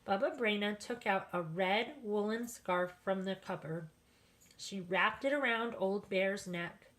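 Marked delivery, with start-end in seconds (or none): none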